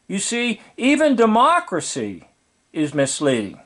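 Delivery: usual speaking voice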